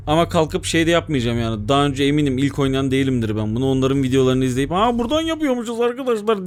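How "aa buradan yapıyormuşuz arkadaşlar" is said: high, lightly mocking youtuber voice; mocking a walkthrough youtuber. Gamer mocks youtubers who copy walkthroughs, high and light. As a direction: parody a know-it-all guide. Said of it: "diyecek biri değilim".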